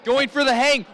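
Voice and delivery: ordinary delivery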